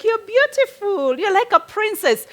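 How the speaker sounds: stretched-out, high-pitched mimicry of a child; child mimicking cartoons. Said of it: "they will even twist voices".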